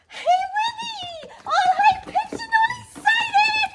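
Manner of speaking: very high, loud squealing praise; all excited. Shown as a demonstration. Trainer showing the excited tone dogs love, squealing excitedly. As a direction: gush over the dog with huge excitement. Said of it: "I can get him really excited".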